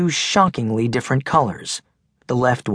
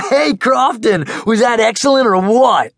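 put-on high, loud goofy boy voice; big teasing kid; 11 semitones higher, 6 dB louder than usual. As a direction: tease your friend loudly, laughing it off. Max teasing Megan.

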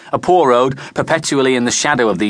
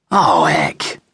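low, dismayed moan; heavy moan of complaint; 6 semitones lower than usual. A narrator's reading. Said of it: "moaned Mickey".

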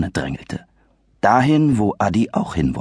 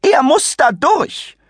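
much higher, slow, sneering rise on er; shrill mock repeat; mocking echo; 4 dB louder, slower than usual. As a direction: parrot the boy's words with scorn. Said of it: "kreischte sie".

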